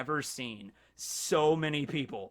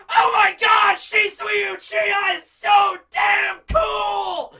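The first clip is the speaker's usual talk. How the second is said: much higher, louder, slow fangirl yell; excited shout; 10 dB louder, slower than usual. Real, not acted. Fan gushing to viewers about Shisui, excited shout. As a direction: scream it like a thrilled fan.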